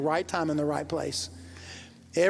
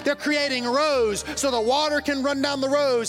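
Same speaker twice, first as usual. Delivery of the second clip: preaching at full force; 6 semitones higher than usual. Acted. Pastor preaching to the congregation about words, full-force fervour. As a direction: lift the church with rising fervour.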